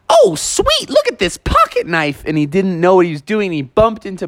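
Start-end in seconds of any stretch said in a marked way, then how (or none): 0.1-2.2 very high, delighted little bear; excited kid bear. Acted. Junior Bear spotting the knife, squeaky and thrilled. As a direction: squeal with glee at the shiny find. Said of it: "Junior Bear came around the corner and said".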